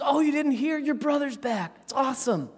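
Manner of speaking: high, eager, bubbly worker voice; a gushing farmhand spilling the news; excited worker. Retold or imitated. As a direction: worker tells the older son his brother is back, high and eager. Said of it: "And the worker says".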